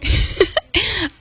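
a high, trailing giggle. Real, not acted. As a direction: laugh it out. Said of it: "I don't know why I'm laughing so much".

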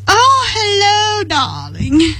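high, slow, sing-song English "Oh, hello, darling"; posh English accent. Retold or imitated. Answering a friend's call in an English accent. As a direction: put on a grand English accent. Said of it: "you pick up an English accent?".

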